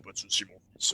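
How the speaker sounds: low breathy whisper of a demon; demonic whisper. Retold or imitated. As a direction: whisper it like a devil granting a favor. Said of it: "avec la voix diabolique".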